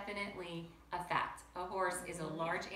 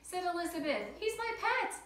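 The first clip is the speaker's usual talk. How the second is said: put-on high, eager child's voice; a small girl protecting her pet; little girl voice; 10 semitones higher, 5 dB louder than usual. A narrator's reading. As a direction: Elizabeth telling her parents about Doug. Child defends her pet bug.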